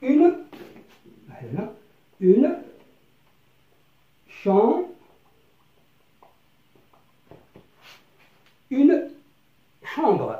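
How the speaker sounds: "une chambre" said loud and high, word by word; loud slow model. Shown as a demonstration. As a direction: call out "une chambre" to the back row. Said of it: "Par exemple".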